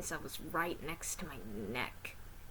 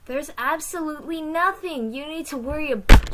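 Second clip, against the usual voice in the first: loud, high confident call; 9 semitones higher, 16 dB louder than usual. Acted. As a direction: brush off the worry loudly. Character reassures loudly.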